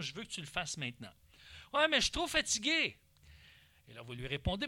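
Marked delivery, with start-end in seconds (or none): 0.0-1.1 posé, doux et ferme, sans hausser le ton; calme mais ferme. Shown as a demonstration. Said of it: "sur un ton calme, mais ferme". 1.7-3.0 plus aigu, plaintif comme un enfant fatigué; enfant qui rechigne. Acted.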